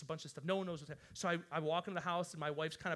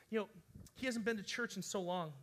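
normal talking voice

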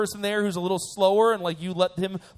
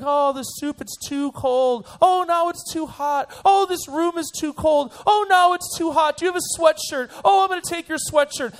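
high, loud, dragged-out complaining; mock whining; 8 semitones higher, 5 dB louder than usual. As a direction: whine like a fussy adult at dinner. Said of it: "They whine and complain".

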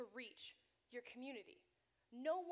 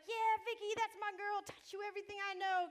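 cheering; 7 semitones higher, 6 dB louder than usual. Retold or imitated. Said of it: "I was like".

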